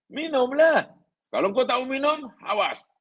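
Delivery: lightly menacing order; mock threatening. Shown as a demonstration. Teacher mocks a threatening drink order, with a hint of menace. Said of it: "Tak ada".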